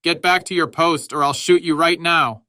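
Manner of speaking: high, loud, angry command; furious order under fire; shouted threat. A narrator's reading. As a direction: roar it as an officer's ultimatum. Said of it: "shouted the Stabs".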